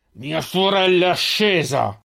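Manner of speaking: ex-hunter growling about his sister; growled. A narrator's reading. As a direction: spit each word out slowly. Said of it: "ringhiò l'ex cacciatore".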